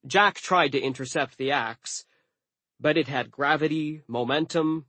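usual speaking voice

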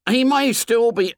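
put-on urgent boy's suggestion; desperate hope. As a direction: grasp at hope in a high voice. Piggy grasps at hope.

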